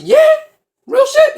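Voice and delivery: very high and loud "real shit?"; squealing loud amazement; excited disbelief. Retold or imitated. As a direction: leap up in wide-eyed hype.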